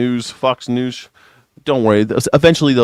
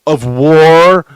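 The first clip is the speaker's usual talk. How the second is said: mock dramatic; 2 semitones higher, 4 dB louder, slower than usual. Mock correction, Secretary of War.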